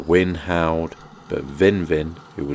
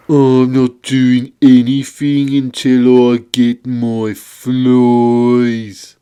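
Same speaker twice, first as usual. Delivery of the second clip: loud, higher Monkey Toad bellow; demanding creature; 7 semitones higher, 9 dB louder, slower than usual. Acted. Monkey Toad refuses Vin without flies, demanding. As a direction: demand it loudly, stretching the end. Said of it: "demanded Monkey Toad".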